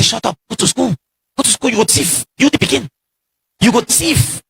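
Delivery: hammed-up scolding parent in pidgin; scolding father. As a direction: bark it like a dad shutting a kid down. Father scolding child, voiced.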